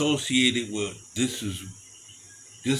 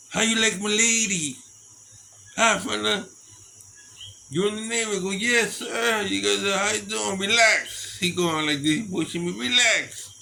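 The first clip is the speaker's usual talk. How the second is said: a lightly voiced gruff old biker, higher; 8 semitones higher than usual. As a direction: play the girlfriend's man, half threatening. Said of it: "The whole old man comes over".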